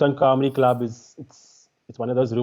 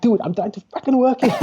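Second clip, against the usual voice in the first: much higher, worked-up voice; retold exasperated protest; 11 semitones higher, 3 dB louder than usual. Comic answering heckler from the stage, exasperated. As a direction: protest indignantly, pitch right up. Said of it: "shouting at each other".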